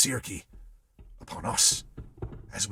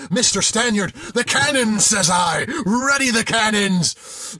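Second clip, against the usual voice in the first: high, loud shout; shouting orders; 10 semitones higher, 8 dB louder than usual. A narrator's reading. Sailor insisting on the cannons, shouting. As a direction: yell over the captain.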